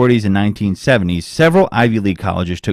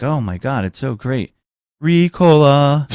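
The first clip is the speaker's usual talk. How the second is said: mock joyful singer; 5 semitones higher, 3 dB louder, slower than usual.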